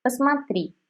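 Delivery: ordinary delivery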